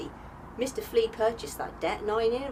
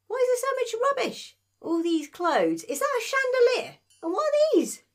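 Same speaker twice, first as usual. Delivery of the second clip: higher, louder, rising disbelief; incredulous dismay; 11 semitones higher, 5 dB louder than usual. Acted. Priya seeing the cluttered starship, mounting incredulous dismay. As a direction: gape at the mess in disbelief. Priya aghast at the messy ship.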